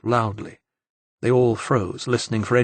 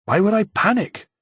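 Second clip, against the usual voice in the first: a touch of nervous panic; 10 semitones higher than usual. A narrator's reading. Enzo starting to panic.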